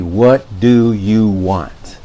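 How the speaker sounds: slow, drawn-out, fed-up statement; exasperated. Shown as a demonstration. Demonstrating to viewers a statement of exasperation. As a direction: let the frustration weigh on each word. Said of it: "The statement of frustration or exasperation".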